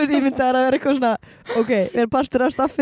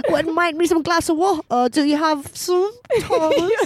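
mock British accent; 4 semitones higher than usual. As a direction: ask Thomas for water, all prim and British. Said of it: "Mætir Helga".

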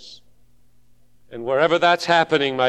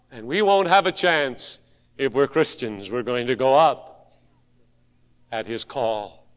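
usual speaking voice

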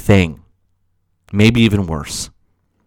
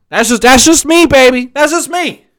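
much higher, cheeky and teasing; playful swagger; 13 semitones higher than usual. Real, not acted. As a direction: brush it off with a cocky grin. Playful sign-off on his rant.

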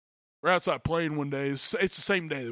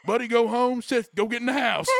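higher, louder retold order; stern dad order; 8 semitones higher, 7 dB louder than usual. Seth's dad sending the boys home, stern mock order. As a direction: bark it like a fed-up father. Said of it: "he goes".

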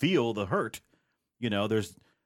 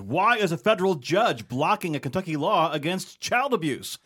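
upbeat announcer read, raised and loud; show intro read; 8 semitones higher, 6 dB louder than usual. Acted. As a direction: sell the headlines like a radio host.

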